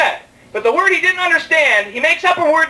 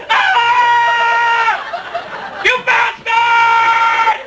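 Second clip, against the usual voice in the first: high, long drawn-out hammed-up scream; retold scream; 8 semitones higher, much slower than usual. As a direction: scream it out as if dragged at top speed.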